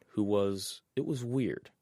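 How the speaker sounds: usual speaking voice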